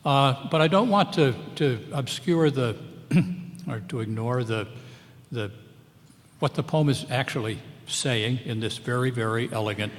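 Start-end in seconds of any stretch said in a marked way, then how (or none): none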